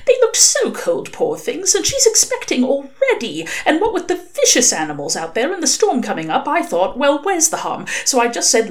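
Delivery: a kind angel anxiously defending his choice; flustered angel. Acted. Angel confesses to demon about the sword, flustered.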